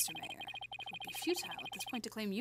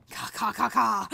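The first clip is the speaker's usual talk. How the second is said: crow caws; 3 semitones higher, 9 dB louder than usual. Acted. Crow cries again.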